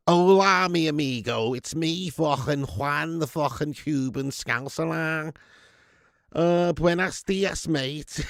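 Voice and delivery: Scouse voice with mock Spanish, slow; hammed-up Latin Scouser impression; mock Cuban Scouser. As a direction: Liverpool accent sprinkled with Spanish. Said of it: "what would a Cuban Scouser be like".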